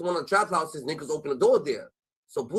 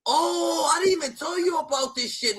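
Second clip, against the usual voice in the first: excited outburst; 10 semitones higher than usual. Real, not acted. Suddenly remembers a story.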